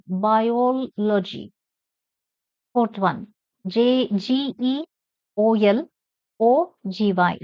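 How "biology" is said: "Biology" with a drawn-out "ol"; stress on OL. Shown as a demonstration. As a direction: say "Biology" very slowly, stretching the OL. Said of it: "Stress on O L".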